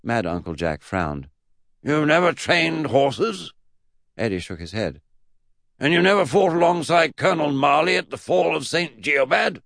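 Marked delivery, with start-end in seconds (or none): 1.8-3.5 loud, slow, incredulous high voice; drawn-out disbelieving question; Mad Uncle Jack. Acted. Great-uncle questions Eddie on horses, slow incredulity. 5.8-9.6 high, loud, incredulous questioning; Mad Uncle Jack. Acted. Great-uncle interrogates Eddie, high loud disbelief.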